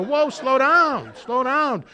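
high, slow whoa, slow down; calming a frantic caller. Retold or imitated. Sponsor calming a frantic newcomer, high and drawn out. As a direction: settle the panicked sponsee down.

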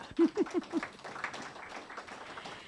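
a soft, breathy high laugh. Real, not acted. Speaker to meeting, breathy laugh about eleven years. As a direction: laugh quietly with relief.

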